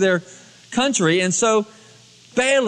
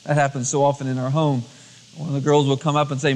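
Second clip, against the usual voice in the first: ordinary delivery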